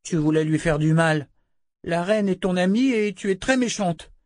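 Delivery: put-on high-pitched child's accusation; accusing little girl. A narrator's reading. Girl distrusts the queen's supposed friend, high accusing voice. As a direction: accuse her in a small girl's high voice.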